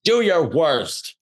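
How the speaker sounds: daring the windy day, slow and defiant; defiant challenge. Retold or imitated. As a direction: dare the wind to try, slowly. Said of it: "I'm like".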